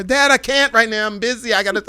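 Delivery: high, loud brush-off; busy daughter. Retold or imitated. Daughter too busy to talk to dad, high hurried brush-off. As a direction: rush dad off the phone. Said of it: "I'm on my way".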